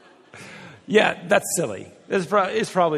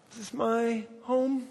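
higher, slow, puzzled question; mock bewilderment; 7 semitones higher, 5 dB quieter, slower than usual. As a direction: act baffled at your own front door. Walking into repainted house.

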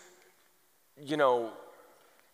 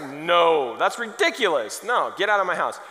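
indignant refusal; 3 semitones higher, 7 dB louder than usual. Acted. Homeowner retelling his answer to a vacuum salesman, loud indignation. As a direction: throw the salesman out, exasperated.

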